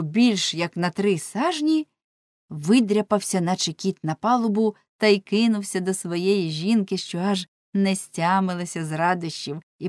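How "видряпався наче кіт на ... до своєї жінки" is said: plain reading voice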